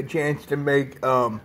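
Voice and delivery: eating from a spoon; talking while chewing food. Real, not acted. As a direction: mouth partly full. Seen 0: spoon goes to mouth around 4.1; chewing face at 4.8.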